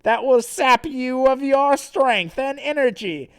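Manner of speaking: high, loud gloating villain; theatrical threat broken by evil laughter; Santa. Acted. Villain taunts trapped Sonichu, loud and gloating. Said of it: "And then Santa says".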